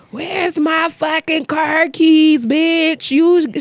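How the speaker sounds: high, drawn-out demand; druggy-sounding woman. Retold or imitated. Dancer arguing with a girl over lost keys, imitated druggy slow whine. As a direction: imitate someone high, dragging the words out slowly. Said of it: "she sounded like she was on some fucking drugs".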